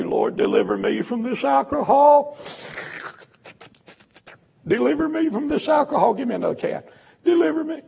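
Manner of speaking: put-on high, loud pleading wail of a drunk begging God; mock drunk's prayer. Preacher mocking a drinker's loud begging prayer. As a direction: ham up a drinker crying out for deliverance.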